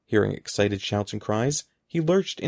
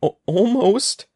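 loud, high startled stammer; 10 semitones higher, 7 dB louder, slower than usual. A narrator's reading. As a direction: trip on the first sound, voice jumping up. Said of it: "Evan stammered".

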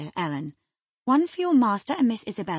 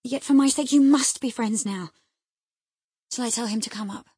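eager pleading, pitched up; slightly repressed delight; 6 semitones higher, 9 dB louder than usual. A narrator's reading. Wife begs husband to welcome her friend, delight slightly held back. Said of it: "repressing a little the intensity of her delight".

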